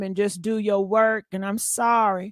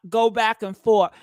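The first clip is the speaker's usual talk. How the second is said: ordinary talk, nothing marked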